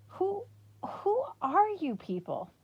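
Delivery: high, slow, half-crying disbelief; can't believe what she's hearing; stunned girl pleading for answers; bewildered. Acted.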